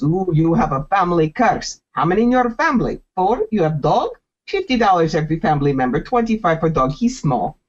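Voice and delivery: hammed-up broken-English fortune teller. Fake psychic hooking a passerby in a bad Russian accent. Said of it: "they do bad Russian accent".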